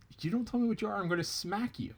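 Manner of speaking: rabbit voice pitched high, cocky threat; threatening rabbit. Acted. Rabbit threatens the silent tar doll, high and cocky. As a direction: warn the doll you'll smack it.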